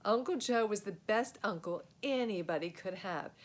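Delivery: ordinary delivery, nothing marked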